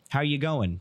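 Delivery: casual Australian-style "How you going", run together; quick mock-Australian greeting; imitating Australians. Shown as a demonstration. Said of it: "Tyler went to Australia".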